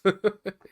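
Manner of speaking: loud sudden burst of laughter; real laugh, high and loud. Real, not acted. Laughs at his own voice.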